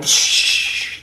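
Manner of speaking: long voiceless "shh"; shushing. Acted. Gnome hushed as the wedding continues, shushing. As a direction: hush the chatter with a sharp shh. Hushing interruption of the ceremony.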